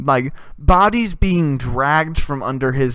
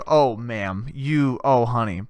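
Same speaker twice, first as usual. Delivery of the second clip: patronizing low voice; 6 semitones lower, slower than usual. Retold or imitated. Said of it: "It's like".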